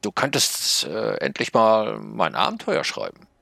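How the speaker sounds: high snail voice, pushing gently; a small magic snail urging the writer; little snail, nagging. Acted. Snail character nags narrator. (hohe Schneckenstimme, drängelnd)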